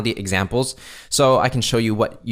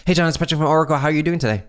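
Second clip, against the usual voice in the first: rushed, higher-pitched greeting; speaking too fast; 7 semitones higher, 3 dB louder, much faster than usual. Shown as a demonstration. Salesperson rushing a cold-call opening, far too fast. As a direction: race through it in one nervous breath. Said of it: "Let's say you're speaking too fast".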